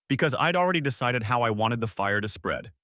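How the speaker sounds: ordinary delivery, nothing marked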